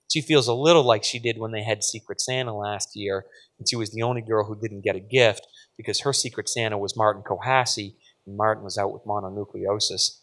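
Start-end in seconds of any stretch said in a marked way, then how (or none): none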